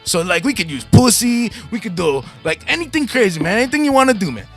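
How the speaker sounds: high, hammed-up radio host voice; gravelly metalhead. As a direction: play Paco Suave the game show host. Introduces self as Paco Suave, host voice.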